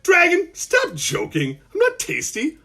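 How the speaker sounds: put-on high-pitched boyish protest. Malcolm objecting to being eaten, high boyish protest. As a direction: pitch it high like an indignant kid.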